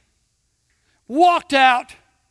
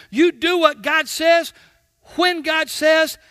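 raised, loud pulpit preaching with a slowed punch on "By all means"; full-force preaching; 3 semitones higher, 4 dB louder than usual. Acted. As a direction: hammer the command home to the congregation. Preacher driving the point.

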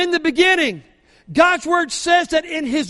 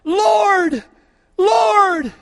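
calling on the Lord; 4 semitones higher, 4 dB louder, much slower than usual. Retold or imitated. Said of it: "you say".

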